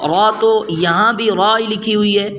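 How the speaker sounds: ordinary talk, nothing marked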